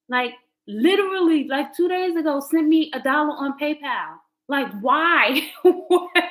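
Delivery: disbelieving why-would-you outburst; incredulous rant. Real, not acted. Vlogger ranting to chat about her ex.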